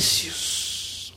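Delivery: breathy whisper of necios; preacher hushes the last word. Acted. Preacher hushing the crowd, whispered.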